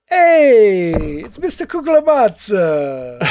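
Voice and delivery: high, loud, hammed-up Italian waiter; a cartoon Italian greeting, laughing through it; mock Italian accent. Cohost imitating a cartoon Italian waiter, hammed-up Italian accent. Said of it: "Italian accent".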